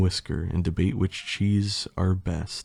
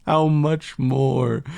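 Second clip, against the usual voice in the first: higher, slow, drawn-out plea; acted cry, stretched out; pleading cry; 5 semitones higher, slower than usual. A narrator's reading. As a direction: beg to know when it ends. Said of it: "I cry".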